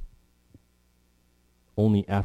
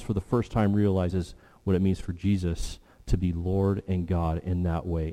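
usual speaking voice

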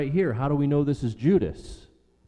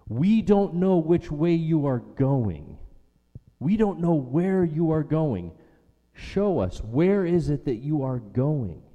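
higher, insistent confused plea; bewildered, raised-pitch protest; completely confused; 4 semitones higher, slower than usual. Acted. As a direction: plead for directions, totally lost. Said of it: "Completely confused".

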